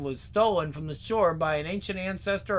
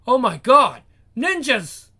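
loud startled shout; alarmed cry; 4 semitones higher, 8 dB louder than usual. Acted. Hero sees attackers.

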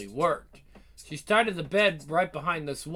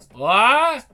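startled shout; 6 semitones higher, 11 dB louder than usual. Acted. Startled by a strange sound.

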